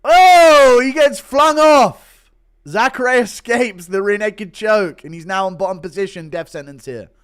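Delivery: loud, high, excited play-by-play call; fight commentary. Acted. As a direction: open on a big drawn-out cry, then call the action like a commentator.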